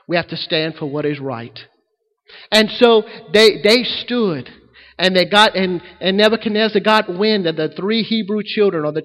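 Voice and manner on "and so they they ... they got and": ordinary delivery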